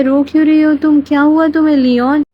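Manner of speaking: urgently asking why she's crying; worried loud question. Retold or imitated. Husband anxiously asking crying Leon what's wrong, loud and high.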